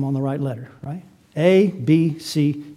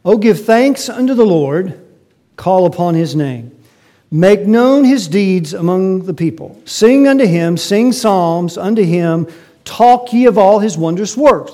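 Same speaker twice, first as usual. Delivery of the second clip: scripture reading; 4 semitones higher, 8 dB louder than usual. Acted. Reads psalm aloud in sermon.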